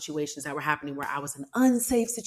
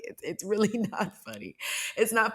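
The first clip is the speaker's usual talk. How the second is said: laughing through it; 5 semitones higher than usual. Real, not acted. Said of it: "I don't know why I'm laughing".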